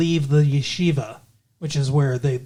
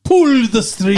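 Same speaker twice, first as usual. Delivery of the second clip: loud, high, hammy horror-star impression; drawn-out melodramatic mock command; Bela Lugosi; 5 semitones higher, 6 dB louder than usual. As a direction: cry it out like an old horror actor, imitating. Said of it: "He played Bela Lugosi from Ed Wood".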